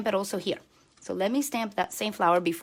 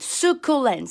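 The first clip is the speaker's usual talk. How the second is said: normal talking voice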